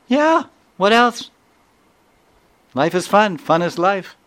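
put-on high, loud child voice, obvious and gleeful; carefree child. The boy tells the visitors in a high gleeful voice that life is fun. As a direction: sing it out like a kid stating the obvious.

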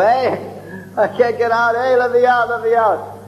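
high pitched calls of a trapped neighbor; high mock cries of let me out; calling out to be let out. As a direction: shout through the barred window.

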